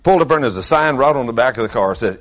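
normal talking voice

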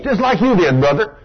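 ordinary delivery

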